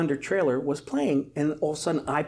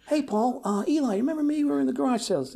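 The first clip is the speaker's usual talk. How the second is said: higher, bright rehearsed hello; eager rehearsed greeting; 12 semitones higher than usual. Retold or imitated. Rehearsing how he'll greet the actor, higher and eager. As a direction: practise an eager greeting to a star. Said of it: "rehearsing in my head".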